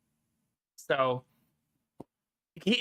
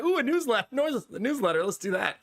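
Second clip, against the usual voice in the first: high, eager and bright-eyed about the newsletter; mock-excited little gush; perked up; 6 semitones higher than usual. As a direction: jump at the idea with a squeal of interest. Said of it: "I was perked up".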